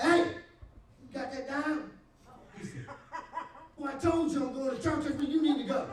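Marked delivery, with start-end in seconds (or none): 0.0-1.9 high, loud shout across the yard; loud high call across the yard. Retold or imitated. 3.8-5.9 laughing refusal. Retold or imitated. Friend refusing, heading to church.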